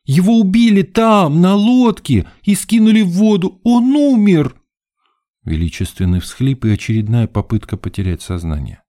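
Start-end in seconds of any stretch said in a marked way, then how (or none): 0.0-4.6 high, loud hysterical wail; indignant. A narrator's reading. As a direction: overwrought diva piling on the drama. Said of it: "возмутилась свидетельница".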